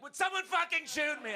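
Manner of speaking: loud, high desperate cry to be shot; desperate yell. Retold or imitated. Comic at fifty, yelling to the crowd in mock despair. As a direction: beg it at the top of your voice. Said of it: "I'm like".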